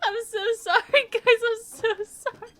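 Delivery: high, squeaky-with-laughter apology; helpless laughing apology. Real, not acted.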